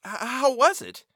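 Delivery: stammering. A narrator's reading. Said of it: "Joy had suddenly paralyzed his tongue".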